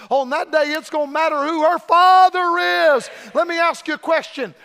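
loud, climbing preacher shout; preaching at full force. Acted. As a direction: thunder it to the crowd. Preached reply, rising to climax.

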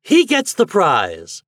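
loud, high, stretched-out announcement; triumphant shout. A narrator's reading. Toad announces Reddy has won, shouting. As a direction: shout out the winner slowly. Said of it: "shouted Toad".

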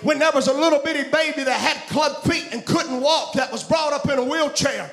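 full-force preaching. Acted. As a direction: preach it out to the congregation.

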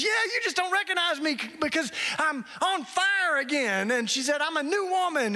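high and bright, overjoyed; woman at the door, beaming with joy; joyful. Retold or imitated. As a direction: sing out her new life. Joyful transformed woman speaks.

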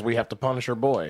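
usual speaking voice

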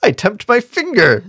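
high, loud comic protest; hammed-up squawk of protest. A narrator's reading. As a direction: object indignantly as a riddling dwarf. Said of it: "Bajaz protested".

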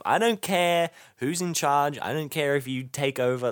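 ordinary delivery

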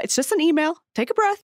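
hammed-up patronising coworker; condescending. Shown as a demonstration. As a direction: belittle a coworker's worries. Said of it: "Let's practice saying it".

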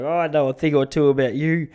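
lightly voiced wise guy; knowing tough talk. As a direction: play it like you know his secrets. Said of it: "I was like".

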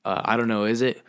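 ordinary delivery

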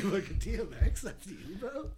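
high teasing second voice; playing both parts. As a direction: switch to the other voice, high and teasing. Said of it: "he be doing both voices".

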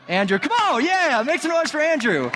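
high, excited cheer; rally applause like a hype man; hyping up a volunteer. Real, not acted. Host calls applause for a new volunteer, hyped up.

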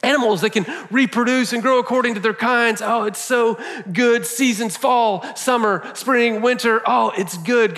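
high, delighted and building, savouring each new creation; a beaming creator calling out each wonder with a smile; joyful God voice. Acted. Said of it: "he keeps working and he goes".

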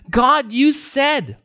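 high, slow, emphatic cry; crying out to God. Retold or imitated. Psalmist crying out to God in doubt, pleading. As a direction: press each word out slowly as a plea. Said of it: "he's crying out to God saying".

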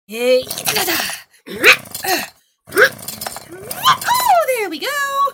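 loud, high effortful cheer; straining exclamation. Acted. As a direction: push hard, then triumph. Sawing the table legs.

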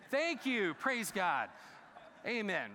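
high, loud, joyful call-out; rally the room with delight; excited praise. Real, not acted.

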